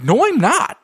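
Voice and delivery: put-on very high kid voice; indignant little boy. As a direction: insist like a stubborn child. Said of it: "he was like".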